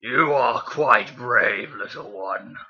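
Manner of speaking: very slow, throaty villain voice; Megatron. Acted. Reader retrying the villain's line for the listener, slow and throaty. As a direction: drag it out from deep in the throat. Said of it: "It's like a deep, throaty voice".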